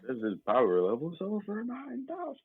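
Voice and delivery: high-pitched, slow meme quote; hammed-up disbelief climbing very high; mock astonishment. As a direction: stretch it out high in pretend shock.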